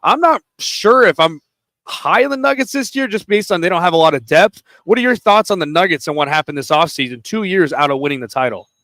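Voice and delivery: normal talking voice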